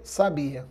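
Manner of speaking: sabia, stressed on the BI; stress on middle syllable. Shown as a demonstration. Teacher contrasting same letters for students, stress on middle syllable. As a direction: lean on the i of sabia, sa-BI-a. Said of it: "e se eu não acentuar".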